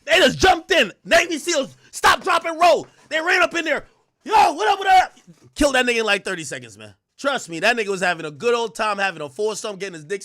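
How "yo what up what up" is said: mock raid shout. Seals storming in, a mock greeting shouted loud. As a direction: shout it high and loud, bursting in.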